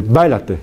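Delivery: "Báilate" with a hard, bursting b; b fuerte incorrecta. Shown as a demonstration. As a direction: pop the b of "Báilate". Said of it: "en vez de decir". («Báilate» con b oclusiva fuerte)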